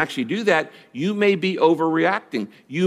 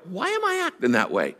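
puzzled self-questioning; 3 semitones higher, 6 dB quieter than usual. Acted.

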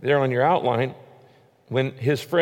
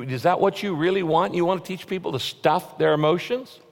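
usual speaking voice

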